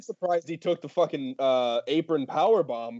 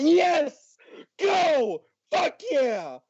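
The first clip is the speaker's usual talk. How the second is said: high, exultant fan cheer; elated cheering; 13 semitones higher, much slower than usual. Retold or imitated. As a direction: relive cheering the wrestler on, pitched way up. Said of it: "I was so happy".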